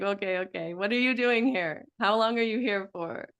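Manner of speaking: ordinary delivery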